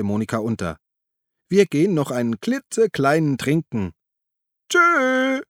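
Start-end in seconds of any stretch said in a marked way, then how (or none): 1.5-5.4 high tipsy sing-out, soaring farewell; drunken bawl. A narrator's reading. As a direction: bawl a merry goodbye, pitched way up. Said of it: "gröhlte Monika". (beschwipst gegrölt)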